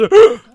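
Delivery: a sharp, loud, high gasp of shock. Retold or imitated. Patient getting test results, a loud shocked gasp. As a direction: gasp as if reading bad news.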